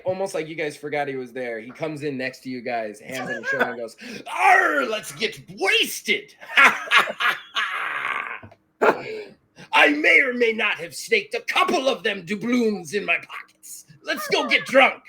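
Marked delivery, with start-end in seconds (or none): 4.3-6.3 high, loud drawn-out pirate roar; rowdy pirate. Acted. Pirate puts hands on friends' shoulders, roaring for drinks. 6.4-8.5 loud, rolling cackle; long pirate guffaw, falling away; hearty pirate laugh. Acted. Pirate laughs with his mates over drinking, rollicking. 8.8-15.0 loud, high pirate voice; roguish pirate. Acted. Pirate confesses pocketed doubloons to his friends, rollicking. Teach boasts of pocketed coins.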